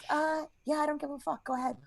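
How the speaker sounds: soft, indifferent mumble of permission; offhand consent. Retold or imitated. Letting the old lady go ahead, soft and offhand. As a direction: wave them through quietly, not caring.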